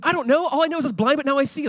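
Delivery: high, bewildered insistence; a man on trial who only knows he sees; blind man testifying. Acted. Healed blind man answering his accusers, high and bewildered. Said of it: "He's like".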